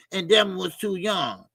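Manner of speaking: ordinary talk, nothing marked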